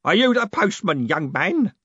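loud, high bellow; old man bellowing at the postman. A narrator's reading. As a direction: boom the question at him.

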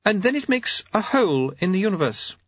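lightly voiced genteel lady; poised noblewoman.

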